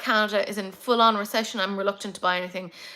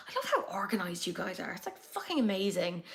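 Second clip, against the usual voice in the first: fond whisper; 3 semitones lower, 8 dB quieter than usual. Real, not acted. Fond whispered aside to chat.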